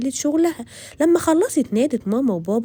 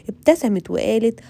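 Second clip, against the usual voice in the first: usual speaking voice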